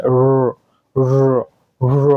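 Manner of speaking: র as a drawn-out "rrr"; held R sound. Shown as a demonstration. Teacher drilling the R sound, র held as "rrr". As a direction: sustain each র, the last opening out. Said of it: "র এর মতো sound হবে".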